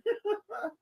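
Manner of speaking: a burst of high giggling; high giggle. Real, not acted. Fan cracking up over the Minions joke, giggling.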